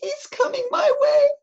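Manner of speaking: very high, squealing alarm; high, loud panicky child voice; frightened child. Acted. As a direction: squeal it like a terrified little boy. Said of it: "whispered Eddie".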